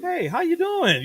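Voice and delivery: put-on a bright greeting from a chipper young guy. Walking into the studio: a mock chipper hello. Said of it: "little chipper guy".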